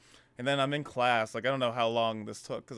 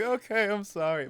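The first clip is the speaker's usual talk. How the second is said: sheepish apology; 10 semitones higher, 3 dB louder than usual. Retold or imitated. Apologizing to angry mom.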